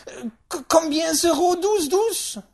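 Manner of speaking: high, loud stammered French; halting French question. Acted. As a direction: fumble the French out excitedly. Great-aunt attempts French question.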